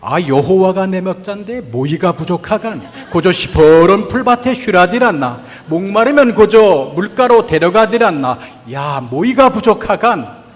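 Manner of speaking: loud, hearty Pyongan dialect reading. Shown as a demonstration. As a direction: belt it out in northern Pyongan dialect. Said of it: "평안도 버전입니다". (큰 소리로 읽는 평안도 사투리)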